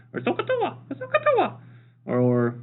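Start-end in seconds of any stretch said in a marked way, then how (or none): 0.2-0.8 squeaky very high mock voice; silly high voice. Shown as a demonstration. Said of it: "say it with an accent like". 0.9-1.6 very high squeaky cartoon voice; silly high voice. Shown as a demonstration. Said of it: "say it with an accent like".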